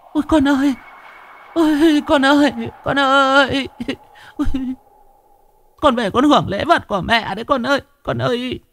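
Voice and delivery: very high, wailing cry of a grieving mother; mother wailing to her son in the wind; wailing lament. Acted. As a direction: cry out to the spirit as if it were there.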